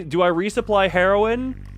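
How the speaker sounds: usual speaking voice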